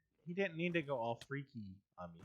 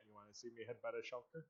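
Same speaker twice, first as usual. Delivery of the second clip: inner goofy voice; 2 semitones lower, 12 dB quieter, faster than usual. Retold or imitated. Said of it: "my brain went".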